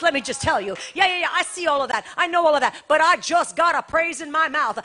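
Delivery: loud, fast preacher's testimony; loud, fast, fired-up preaching; full force preaching. Acted. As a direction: shout the praise over the doubters.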